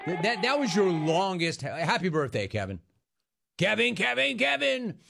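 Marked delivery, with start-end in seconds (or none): 3.6-5.0 sing-song name call. Acted. Host sings out caller's name.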